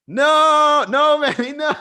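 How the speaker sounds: high, loud drawn-out no; playful wail of warning; mock horrified warning. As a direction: cry out a hammed-up no, breaking into laughter. Mock warning to his past self.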